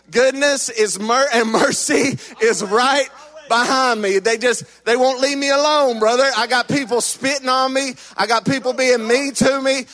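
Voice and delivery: loud, driving preaching; fiery preaching. Acted. Preacher exhorting the congregation about goodness and mercy, loud and driving. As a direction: preach it to the back row.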